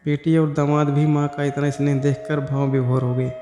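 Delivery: usual speaking voice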